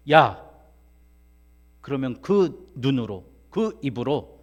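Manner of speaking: loud, emphatic command in bursts; God's stern rebuke. Acted. Preacher voicing God answering the praying pastor, loud and commanding. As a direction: call out sharply, then land each phrase. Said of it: "하나님이 이렇게 말씀하시더래요".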